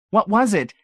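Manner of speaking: high, loud frightened plea; beg, trembling with fright; frightened pleading. A narrator's reading. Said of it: "pleaded, trembling with fright".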